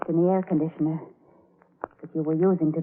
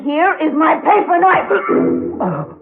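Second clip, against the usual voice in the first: menacing reveal; 9 semitones higher, 8 dB louder, much slower than usual. Acted. Laura reveals the knife, menacing.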